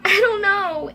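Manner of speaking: lightly acted stammer before crying; stammering, near tears. A narrator's reading. Emily answers Mom, acted stammer starting to cry. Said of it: "stammered, starting to cry".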